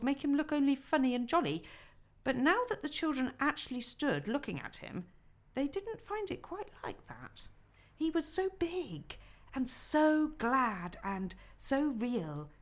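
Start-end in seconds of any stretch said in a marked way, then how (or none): none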